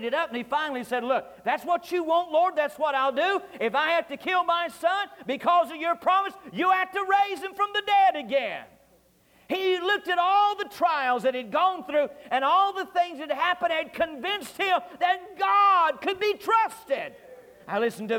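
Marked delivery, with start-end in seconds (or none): 1.0-8.6 rising higher to a shout on dead again; Abraham crying out to God, building up; preached cry of faith. Acted. Abraham answers God, preached aloud. 9.5-17.1 preaching climax. Real, not acted.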